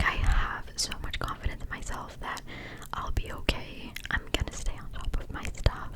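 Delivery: usual speaking voice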